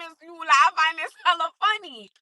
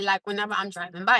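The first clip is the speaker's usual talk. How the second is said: ordinary delivery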